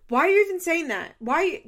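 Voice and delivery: loud, high, indignant yelling; angry outburst. Retold or imitated. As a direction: fire back at her mom, outraged. Jade snapping at her mom.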